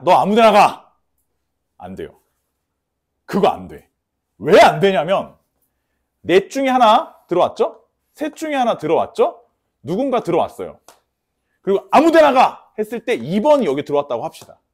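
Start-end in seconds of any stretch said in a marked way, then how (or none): 0.0-1.0 loud, dismissive send-off; careless command. Acted. Teacher carelessly sending the last item anywhere, loud dismissive command. 11.9-12.7 loud, high dismissive order; careless command. Acted. Teacher acting out random placement of the last card, loud careless command.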